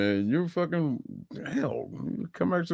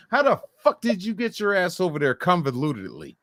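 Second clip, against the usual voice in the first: ordinary talk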